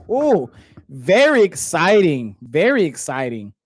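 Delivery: drip sarcasm over the word exciting; deadpan fake excitement; sarcastic. Real, not acted. Sarcastic about boring game.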